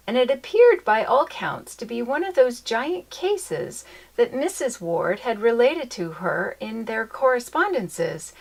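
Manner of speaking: usual speaking voice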